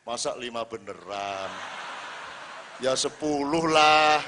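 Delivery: low, then a loud slow ya sepuluh lah; hammed-up cheeky haggle; cheeky fisherman haggling. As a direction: bargain with God shamelessly. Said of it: "gini lagi".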